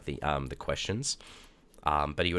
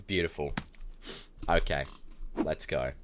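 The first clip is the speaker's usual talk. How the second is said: ordinary talk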